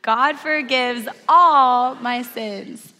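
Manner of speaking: loud, slow, drawn-out declaration; leading group recitation. Real, not acted. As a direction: lead the room in saying it together, loudly. Leads congregation saying it together.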